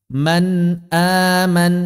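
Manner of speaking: chanted slowly with a clear n in "Man aman"; izhar jelas. Shown as a demonstration. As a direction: recite "Man aman" stretched, every n clear. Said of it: "seperti itu". ("Man aman" dilantunkan pelan, nun jelas)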